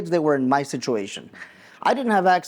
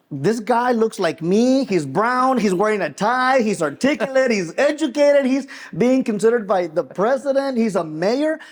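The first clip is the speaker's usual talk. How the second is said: higher, excited disbelief; amazed realization; 6 semitones higher than usual. Retold or imitated. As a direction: marvel at it, voice rising. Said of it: "doing a double take and thinking".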